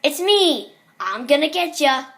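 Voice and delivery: loud, high mascot catchphrase; big theatrical chase shout; Foxy. Acted. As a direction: call it out like a playful pirate animatronic. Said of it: "Foxy always said".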